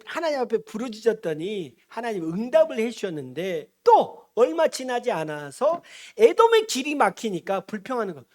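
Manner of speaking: normal talking voice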